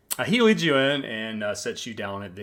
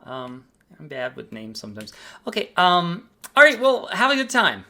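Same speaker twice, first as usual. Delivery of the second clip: bright, high, eager front desk voice; a cheerful clerk welcoming a guest; chipper hotel clerk; 5 semitones higher, 5 dB louder than usual. Acted. Receptionist greets the guest checking in, high and cheerful.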